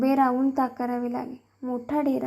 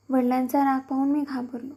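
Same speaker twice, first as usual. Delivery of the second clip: usual speaking voice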